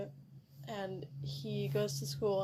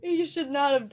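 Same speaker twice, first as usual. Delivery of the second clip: louder, laughing through it; 5 semitones higher, 8 dB louder than usual. Real, not acted. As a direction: still giggling as you speak. Laughing after quote.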